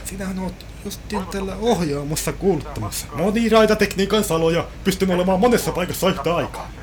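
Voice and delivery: hammed-up cabin crew pitch; chirpy flight attendant. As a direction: play the overly keen steward selling snacks.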